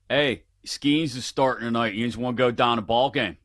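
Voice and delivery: casual Pittsburgh accent, syllables smashed together; Yinzer accent. Shown as a demonstration. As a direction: run it together like a Pittsburgh local. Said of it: "use it in a sentence".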